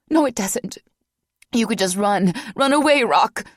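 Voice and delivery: desperate, out of breath; panting, high-pitched plea. Acted. Vanessa pleading.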